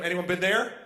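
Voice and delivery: ordinary talk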